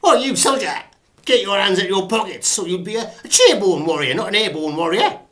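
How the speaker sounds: high, very loud parade-ground bellow; army drill shout, full force; sergeant major. Acted. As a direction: bawl out a soldier across the drill square. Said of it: "Sergeant Major in the army".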